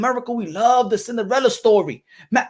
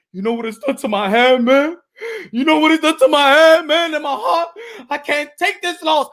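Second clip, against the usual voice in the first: hammed-up sobbing complaint; mock crying; 2 semitones higher than usual. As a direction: whine through fake tears. Wilder breaking down over loss.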